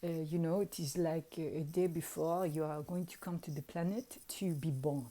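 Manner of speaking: quiet accented impression of an adviser; imitated wizard. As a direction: imitate a gently cautioning accented mentor. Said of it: "he was like".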